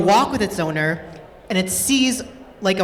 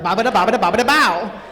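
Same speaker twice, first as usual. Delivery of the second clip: mock eager gobbling; faster than usual. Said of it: "they're like".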